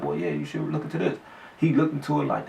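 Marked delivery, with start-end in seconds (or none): 0.0-1.2 low, soft friend voice; a lightly voiced friend, low and hushed; quoting the friend in a low hush; friend's voice.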